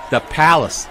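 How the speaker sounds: ordinary delivery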